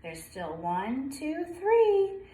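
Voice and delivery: ordinary delivery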